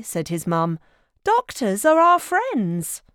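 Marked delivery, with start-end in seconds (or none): 1.2-3.0 high, gentle soothing mother; reassuring mum. A narrator's reading. Mum reassuring Splat about doctors, gentle. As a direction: comfort a nervous child.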